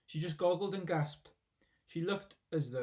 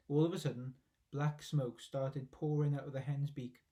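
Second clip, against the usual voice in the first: ordinary delivery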